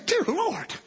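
high, rushed amazement; mock astonishment. Preacher voicing the reaction Balaam lacks, mock amazed. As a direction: gabble in high-pitched disbelief.

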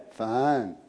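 low, soft, sulking one-word answer; sulky pout. Retold or imitated. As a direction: sulk the word out. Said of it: "So they just pout".

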